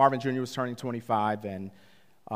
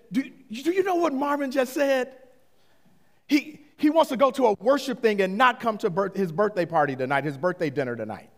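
outraged dad tattling to his wife; huffing and puffing; 9 semitones higher, 4 dB louder, faster than usual. Retold or imitated. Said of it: "I'm huffing and puffing".

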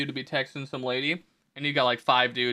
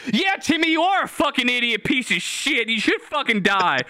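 much higher mock-aggressive troll voice; mock troll tirade; 9 semitones higher than usual. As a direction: play the overzealous defender who goes too far. Overeager member insults Timmy.

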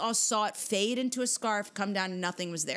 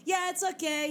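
imitated guest reply; 11 semitones higher than usual. Voicing the forgotten guest's reply.

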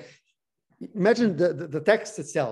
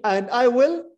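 "I will" slow and louder; stress on I will; 7 semitones higher, 6 dB louder, slower than usual. Shown as a demonstration. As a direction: hit "I will" so they can count it. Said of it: "this is like three times".

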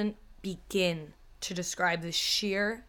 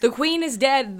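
louder mock announcement; dramatic announcement; 5 semitones higher, 9 dB louder than usual. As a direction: declare it like breaking news. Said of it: "this is what I'm opening with".

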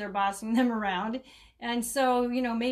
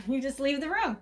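ordinary talk

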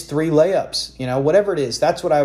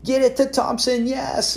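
much higher, gleeful mock cheer; imitating excited cheering; 10 semitones higher, slower than usual. Rival Eric cheering the game on TV, gleeful mock cheer. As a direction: cheer like a rival watching his win. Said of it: "He's probably watching it on Monday night going".

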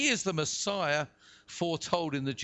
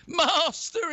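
high, loud cry for help; yell; 10 semitones higher, 5 dB louder than usual. A narrator's reading. Peter sinking in the waves to Jesus, yelling. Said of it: "he yelled".

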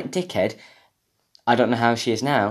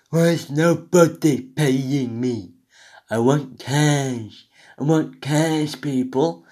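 slow, drawn-out whiny begging, mocking; mock entitled streamer; 4 semitones higher, 3 dB louder, much slower than usual. Podcaster mimicking a streamer begging viewers for cash, mock entitled. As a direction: stretch every word in a put-on demanding voice. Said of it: "I wouldn't sit there going".

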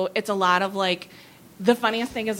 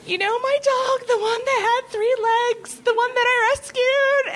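high, tearful mock old-lady voice; imitating a crying old lady. Imitating Joan Rivers crying to the writer on the phone, high and tearful. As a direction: squeeze it out high like a weepy friend. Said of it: "And she was indeed crying".

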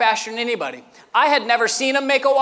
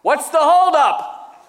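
slow, higher-pitched mock yell; retold yell; 5 semitones higher, 4 dB louder, slower than usual. Supervisor yelling back to the slow cook, mock yell. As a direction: holler it impatiently across a kitchen. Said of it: "Gabe's yelling back".

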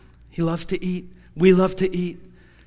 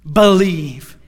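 voice of God; 3 semitones lower, much slower than usual. Retold or imitated. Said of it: "I heard God say".

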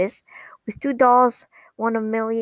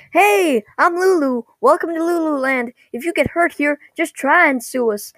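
high-pitched theme park mascot voice; Lulu; 6 semitones higher than usual. Retold or imitated. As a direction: greet guests as a peppy costumed mascot. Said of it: "guy in an apple costume called Lulu".

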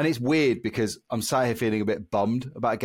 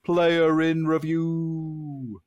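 slow, higher spooky announcer; 9 semitones higher, 4 dB louder, much slower than usual. Acted. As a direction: draw it out like a spooky host. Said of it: "my slightly spooky voice".

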